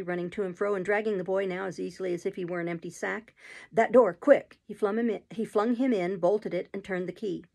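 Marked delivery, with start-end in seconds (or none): 3.7-4.6 loud, urgent order; fierce command. A narrator's reading. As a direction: order them to hurry, fiercely. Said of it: "cried Sykes fiercely".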